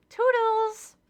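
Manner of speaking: a sarcastic chirpy farewell; facetious goodbye. Acted. Leaving the demanding boss, a facetious high chirp. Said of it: "I'm being a little facetious".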